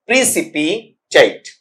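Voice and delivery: "precipitate" said very slowly, every syllable drawn out and clear; careful model pronunciation. Shown as a demonstration. Teacher slowly models the word for students, very slow and clear. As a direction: spell out "precipitate" slowly for the class. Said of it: "इसे क्या पढ़ा जाएगा".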